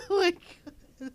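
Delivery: high, slow laugh-filled oh my god; real laughter. Real, not acted. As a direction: gasp it out through laughing. Helpless laughter.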